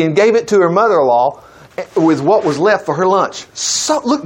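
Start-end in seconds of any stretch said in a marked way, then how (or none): none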